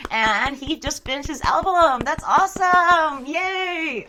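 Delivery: loud, high, drawn-out cheering; real excitement. Real, not acted. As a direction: celebrate a friend, stretching the praise out. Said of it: "I sound sarcastic when I'm excited".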